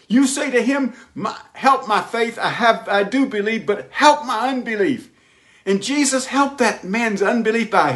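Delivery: high, ringing preacher's cry; fervent preaching. Acted. Pastor urging the congregation to pray, fervent high preaching. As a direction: lift the prayer up with fervor.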